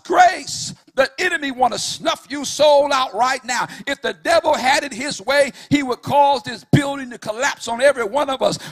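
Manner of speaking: loud, high, chanted sermon cadence over the organ; whooping preacher at full force; full-force preaching. Acted. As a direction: preach it hard, riding the music.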